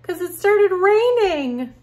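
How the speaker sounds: excited explanation. Acted. Answering her own question dramatically.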